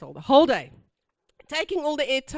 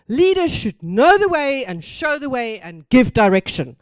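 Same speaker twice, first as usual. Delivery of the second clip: louder, preachy recital of the mantra; mock business-school mantra; 9 dB louder than usual. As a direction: recite it like gospel you swallowed whole. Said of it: "the Kool-Aid goes like this".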